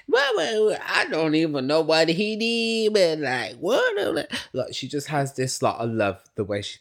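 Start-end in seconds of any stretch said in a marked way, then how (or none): 0.1-4.3 high, hammed-up country accent; [name]. Baffled by what he did, mock country accent. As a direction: draw out a put-on country twang, high up. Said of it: "[name] gives this real country accent".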